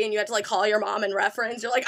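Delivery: ordinary talk, nothing marked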